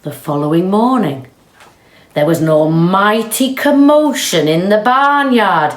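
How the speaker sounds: ordinary delivery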